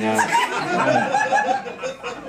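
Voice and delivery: high laughing stammer. Real, not acted. Laughing about the contractions story. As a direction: break into laughter.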